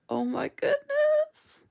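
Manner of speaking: very high, slow squeal of delight; long high-pitched swoon. Real, not acted. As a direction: melt over how pretty it is. Gushing over floral stickers.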